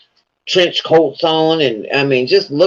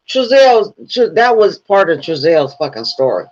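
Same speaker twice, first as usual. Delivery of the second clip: usual speaking voice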